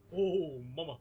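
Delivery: swagger like Johnny Bravo, low; Johnny Bravo impression. Streamer doing a Johnny Bravo for chat, deep swagger. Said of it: "I can do a Johnny Bravo".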